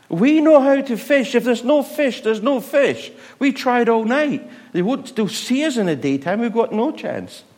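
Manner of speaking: high, loud, indignant fisherman voice; protesting fishermen. Retold or imitated. Fishermen telling Jesus they know better, high and loud. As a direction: push back like a know-it-all fisherman.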